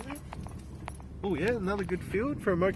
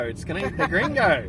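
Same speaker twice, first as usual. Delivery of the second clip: ordinary talk, nothing marked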